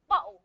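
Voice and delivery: "Bottle" cut in the middle by a glottal stop; London glottal stop. Shown as a demonstration. Teacher repeats it for students, "Bottle" with a glottal stop. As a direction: say "Bottle" without its t, closing the throat instead. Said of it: "The glottal stop".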